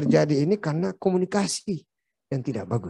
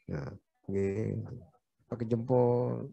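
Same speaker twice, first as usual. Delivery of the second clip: much softer and slow, humble; soft Jogja politeness; 4 semitones lower, 7 dB quieter, slower than usual. Shown as a demonstration. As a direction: ease it out gently like a polite Javanese. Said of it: "Suaranya pelan gitu". (pelan dan lambat, sopan ala orang Jogja)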